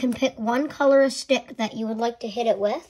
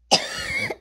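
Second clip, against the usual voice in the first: tiny high-pitched cough, clearly put on; a toy coughs from too much excitement, squeaky cough; squeaky fake cough. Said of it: "I got a bit too excited".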